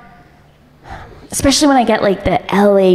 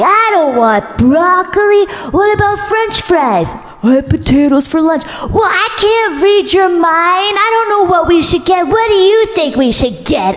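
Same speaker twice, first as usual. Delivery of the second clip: mock bickering wife; 10 semitones higher than usual. Old couple bickering at table.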